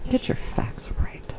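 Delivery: hushed unvoiced whisper, slow. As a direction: whisper it like a secret. Teasing correction.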